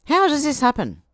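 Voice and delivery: loud, high indignant question. Real, not acted. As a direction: demand it with outrage.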